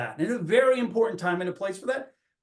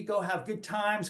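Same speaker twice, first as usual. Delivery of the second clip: normal talking voice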